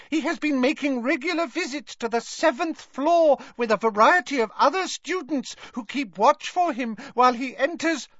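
very high-pitched squeaky elf voice; Dobby squeaking. A narrator's reading. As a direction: report it in a tiny, piercingly high squeak. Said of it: "squeaked Dobby".

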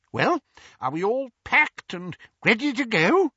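high, eager little wizard voice; a chirpy, over-polite wizard explaining the plan; Daedalus's high, excitable voice; fussy wizard Daedalus. A narrator's reading.

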